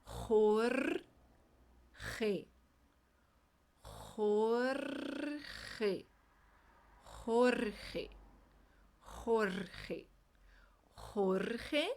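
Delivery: "Jorge" drilled with throat J sounds; teacher repeating a hard name; repeated drill word. Shown as a demonstration. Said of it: "Esse nome é mesmo desafiador".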